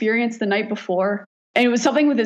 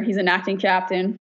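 ordinary talk

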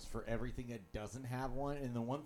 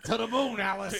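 high, loud mock threat; sitcom husband shout; 11 semitones higher, 13 dB louder than usual. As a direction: bellow it like a blustering husband.

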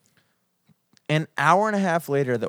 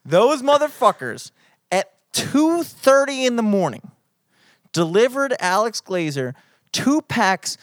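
furious; 6 semitones higher, slower than usual. Real, not acted. Friend venting about the candy cigarettes delivery, furious. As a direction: hit each word with slow, drawn-out indignation. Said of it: "I'm furious right now".

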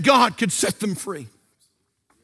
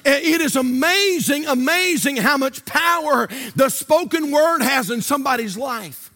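higher-pitched, driving sermon delivery; preaching at full force; 4 semitones higher than usual. Real, not acted. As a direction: preach it with urgent exhortation, phrase after phrase.

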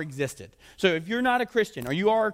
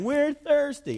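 high-pitched mock wail; hammed-up whiny cry; 7 semitones higher, much slower than usual. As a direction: imitate a crying complaint, pitched up. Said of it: "they started crying".

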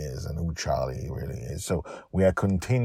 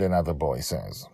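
ordinary delivery

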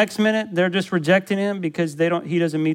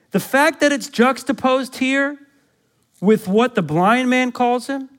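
impassioned preaching; 7 semitones higher than usual. Acted. Pastor preaching the text to the church, raised and urgent. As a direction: preach it out to the congregation.

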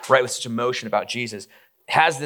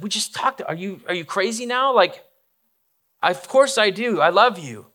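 higher-pitched, incredulous comeback; exasperated protest; 7 semitones higher than usual. Retold or imitated. Peter to Jesus, asked again if he loves him, exasperated. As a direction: protest being asked twice, pitch jumping up.

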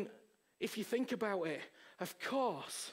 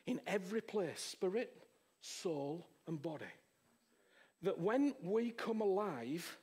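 ordinary delivery

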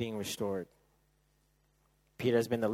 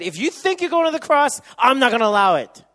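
much higher, louder protest as Peter; Peter's loud refusal; 13 semitones higher, 15 dB louder than usual. Acted. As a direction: shout the refusal like brash Peter. Peter forbidding the cross.

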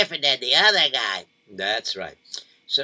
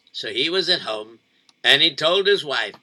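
usual speaking voice

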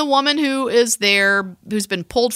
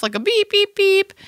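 high, sharp beep beep; imitated alarm beeps; 12 semitones higher than usual. Telling the cohost about the alarm, high imitated beeps. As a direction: mimic the cockpit alarm.

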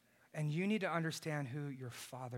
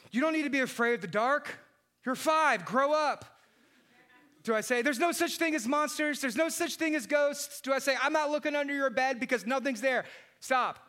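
louder, higher, curt brush-off, mock stern; dismissive dad; 10 semitones higher, 8 dB louder than usual. Dad dismissing his frightened daughter, mock-stern and louder. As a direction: play a stern dad waving off a scared kid. Dismissive parent option mocked.

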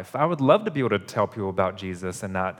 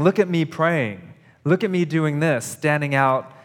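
higher, louder mock boasting; lightly hammed-up bragging hypocrite; showing-off hypocrite; 5 semitones higher, 5 dB louder than usual. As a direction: parade your piety like a show-off. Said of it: "That's a hypocrite talking".

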